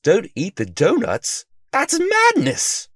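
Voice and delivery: slow, high, loud cry of disbelief; outraged disbelief. A narrator's reading. Detective reading the note forbidding donuts, outraged disbelief. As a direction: stretch it out, shocked and indignant. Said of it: "Sylvester said".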